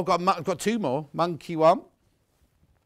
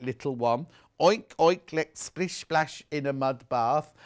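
put-on reading to a toddler; 3 semitones higher, 5 dB louder than usual. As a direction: ham up the animal sounds. Reads the farm book aloud.

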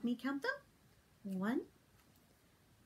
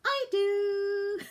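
high puppet voice; 8 semitones higher, 7 dB louder than usual. Acted. Puppet answering at bedtime.

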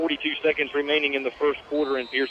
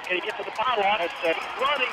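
higher, hushed excited call; excited play-by-play; 7 semitones higher, 6 dB quieter than usual. Real, not acted. Announcer calling the run to the pylon, excited and higher. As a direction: lift up as he reaches the pylon.

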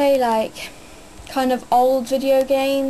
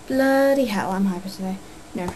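normal talking voice

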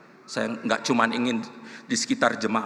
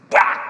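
sudden explosive "bak"; loud thump imitation; 11 dB louder than usual. Preacher imitating his fist hitting the car, loud bang. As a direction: shout the sound of a punch.

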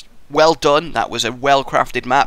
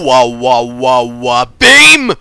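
angry wah wah; 9 dB louder, much slower than usual. Shown as a demonstration. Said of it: "You gotta say it like a wah".

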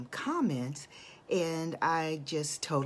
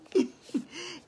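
high delighted laugh. Real, not acted. Laughs after greeting viewer.

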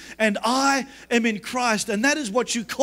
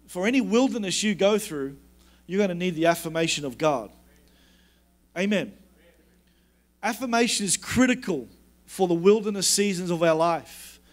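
ordinary delivery

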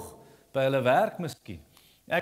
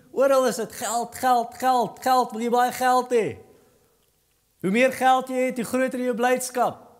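fiery preaching; 7 semitones higher than usual. Acted. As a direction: preach it at full force, hammering each point. Building rant about money everywhere.

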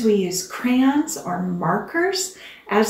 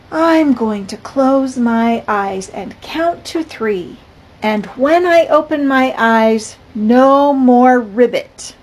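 put-on lively, louder storybook reading for kids; picture book read-aloud; 3 semitones higher, 7 dB louder than usual. A narrator's reading. Teacher reading a picture book to her class, lively.

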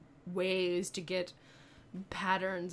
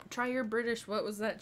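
ordinary talk

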